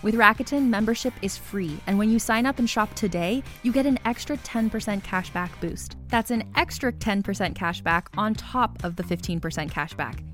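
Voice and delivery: ordinary delivery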